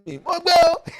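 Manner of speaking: high, loud mock scream; retold scream. Teller re-enacting their panic at the cobra, high retold scream. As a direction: cry out in comic panic. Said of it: "I was screaming".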